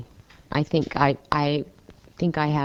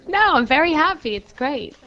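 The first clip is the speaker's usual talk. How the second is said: laughing; 12 semitones higher than usual. Real, not acted. Laughing after a joke.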